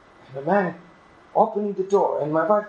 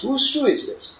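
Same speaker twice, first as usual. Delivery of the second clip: mock confrontation; 8 semitones higher than usual. Husband confronting wife.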